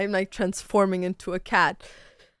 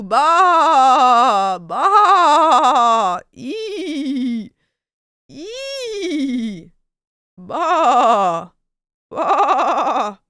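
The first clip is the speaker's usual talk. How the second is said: loud bleating bah like a sheep; sheep bleats; 2 semitones higher, 6 dB louder, much slower than usual. Acted. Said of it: "Turning into a sheep".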